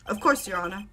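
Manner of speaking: normal talking voice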